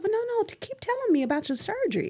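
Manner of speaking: put-on high, quick voice of a little old lady; eager cashier. Cashier asking a customer about surgery, eager and high. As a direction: urge her on in a high, hurried voice. Voicing elderly cashier urging her on.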